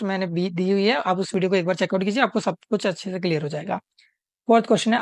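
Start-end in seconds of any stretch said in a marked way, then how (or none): none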